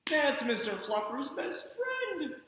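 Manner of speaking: put-on slow protest with a laugh, little boy; child voice. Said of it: "Spencer protests about his toy".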